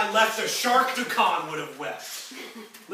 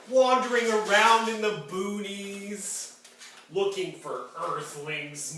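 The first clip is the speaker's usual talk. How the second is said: normal talking voice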